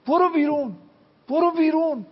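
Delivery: retold angry shout, pitched high and loud; mounting yell, imitating someone throwing a person out; harsh angry order. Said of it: "با تندی من گفتم".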